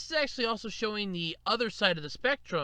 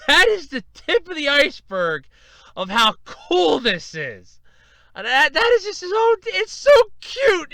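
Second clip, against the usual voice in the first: loud, high-pitched raving, key words stretched; overwhelmed gushing; 8 semitones higher, 9 dB louder than usual. Real, not acted. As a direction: rave at full volume, overcome by cuteness. Overwhelmed by cute picture, gushing.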